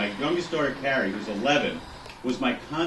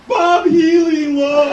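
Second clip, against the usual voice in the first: imitating wailing child; 11 dB louder, much slower than usual. Tearful daughter wailing the reason.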